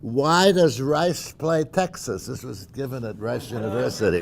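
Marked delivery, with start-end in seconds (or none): 0.0-2.3 louder, higher rousing oratory; rally the crowd like a president at a podium; formal speech. Shown as a demonstration. Reading Kennedy Rice speech.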